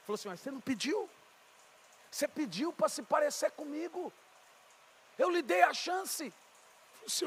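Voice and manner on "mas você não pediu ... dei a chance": higher-pitched, pointed reproach; divine reproach. Retold or imitated. Voicing God reminding him of the chance given, reproachful.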